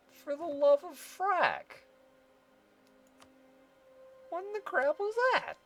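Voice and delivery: high, loud exclamation of disbelief; exasperated outcry. Real, not acted. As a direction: cry out in high-pitched exasperation. Exasperated outburst at the game.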